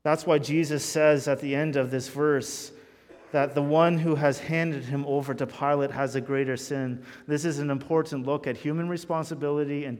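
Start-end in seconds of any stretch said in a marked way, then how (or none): none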